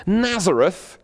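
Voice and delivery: high-pitched disbelief on a place name; incredulous exclamation. A narrator's reading. Sceptical Nathanael hears where Jesus is from, incredulous exclamation. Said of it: "exclaimed Nathanael".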